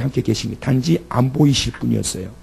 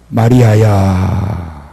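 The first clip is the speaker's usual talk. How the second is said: lower, slow, drawn-out tender call; Jesus calling Mary; 6 semitones lower, much slower than usual. Acted. Jesus calls the weeping Mary by name, low and drawn out. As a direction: call her name gently, stretching every syllable.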